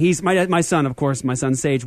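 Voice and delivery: usual speaking voice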